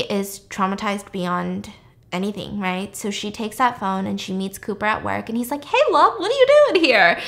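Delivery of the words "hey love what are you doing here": high, loud imitated boyfriend hello; bubbly mock greeting, pitched way up; cheery greeting. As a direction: chirp a surprised hello to a sweetheart. Boyfriend cheerfully greets her at work.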